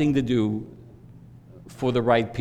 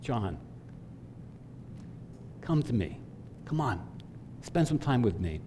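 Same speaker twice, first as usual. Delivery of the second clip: wife's gentle voice; 10 dB quieter than usual. Retold or imitated. God calling him in wife's voice, tender and soft. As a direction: coax him gently to come pray. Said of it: "In my wife's voice".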